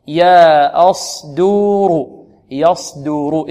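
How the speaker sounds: "يصدور" very slowly, thin ي and د, thick ص and ر; light-heavy alternation. Shown as a demonstration. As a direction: stretch "يصدور", switching light and heavy letter by letter. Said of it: "الياء مرقة والصاد مفخمة". ("يصدور" بترقيق وتفخيم متتابعين)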